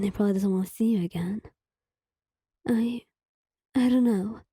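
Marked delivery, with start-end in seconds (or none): 2.7-4.4 halting, rising stammer; nervous stammer. Acted. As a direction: stumble over the first word. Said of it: "you stammered".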